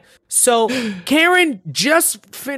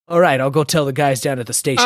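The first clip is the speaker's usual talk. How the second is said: casual, lower mock cop voice; no panic; 7 semitones lower, faster than usual. Imitating cop after he gets it off him, low and unbothered. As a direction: mention it low, as if nothing happened. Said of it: "no panic".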